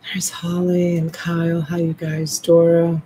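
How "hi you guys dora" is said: usual speaking voice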